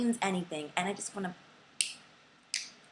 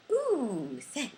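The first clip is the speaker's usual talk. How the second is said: high leering ooh; mock leering guy; 4 semitones higher, 4 dB louder than usual. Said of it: "they'll probably be like".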